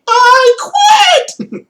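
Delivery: mock a bad actress's squeaky outburst; imitating a squeaky, overblown quit line; weird high pitch. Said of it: "Like this weird high pitch".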